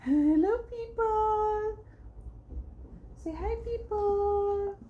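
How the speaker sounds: high, long drawn-out greeting. Real, not acted. Mother greets for her baby. (mataas at pahabang pagbati na pambata)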